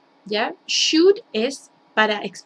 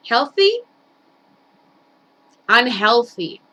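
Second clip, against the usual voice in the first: loud, slow, emphatic quiz questions; classroom drill; 6 dB louder, slower than usual. Shown as a demonstration. Teacher quizzing students on healthy or unhealthy, loud and slow.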